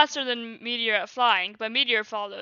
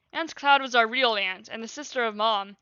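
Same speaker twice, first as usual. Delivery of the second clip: usual speaking voice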